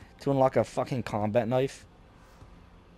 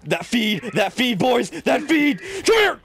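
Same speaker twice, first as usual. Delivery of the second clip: rising, louder and louder hype; building hype yell, high and loud; hyped shouting; 9 semitones higher, 4 dB louder than usual. Real, not acted. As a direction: yell it like you just wiped a squad. Hyped after a multikill.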